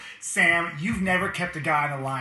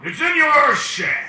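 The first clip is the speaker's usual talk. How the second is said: booming genie voice; 10 semitones higher, 11 dB louder, slower than usual. Acted. Genie grants wish.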